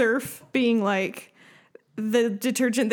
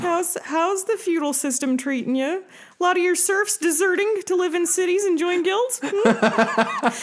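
clueless American; 8 semitones higher than usual. Pretend. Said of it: "every European country is the old country".

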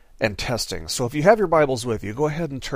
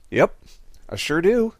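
casual; 5 semitones higher than usual. Acted. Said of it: "casually shrugs his shoulders and he says".

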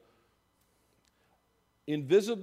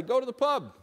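bold proclamation; 10 semitones higher, 6 dB louder than usual. Shown as a demonstration. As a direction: Go to the pub called out high and loud, pub hit hard. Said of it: "But now I say".

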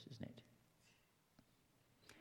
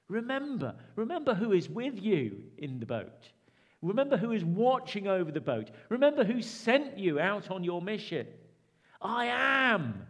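Jesus urging, higher and louder; Jesus rallying his frightened disciples, raised voice; voicing Jesus; 6 semitones higher, 7 dB louder than usual. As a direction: call out the reminders like Jesus.

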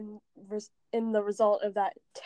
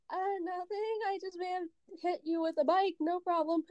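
lightly voiced, higher and softer sheepish reply; sheepish excuse; 6 semitones higher, 3 dB quieter than usual. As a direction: play it innocent, a bit higher. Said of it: "I'm like".

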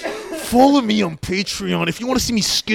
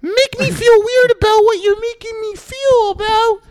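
put-on much higher and slower, a squeaky little boy; 4 dB louder, slower than usual. Podcast host doing a squeaky kid voice for laughs. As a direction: stretch it out high like a child. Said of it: "I can't talk like this".

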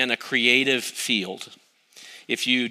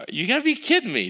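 sharply higher, fast, disbelieving; incredulous outburst; 11 semitones higher, faster than usual. Retold or imitated. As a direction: blurt it out in disbelief, like a gut reaction. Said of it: "I thought".

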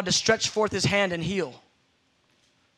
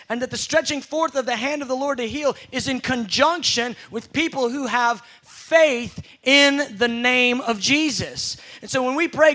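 ordinary talk, nothing marked

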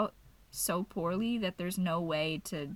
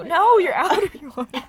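loud, high laughing cry; laughing exclamation; 9 semitones higher, 14 dB louder than usual. Real, not acted. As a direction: blurt it out laughing. Laughing at friend.

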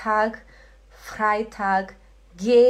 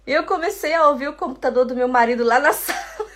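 fast, higher, breaking into laughter; real laughter; 4 semitones higher, 4 dB louder, much faster than usual. Real, not acted. Teacher tells students about husband's computer on Bluetooth, laughing. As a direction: laugh your way through the story. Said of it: "Tô rindo aqui".

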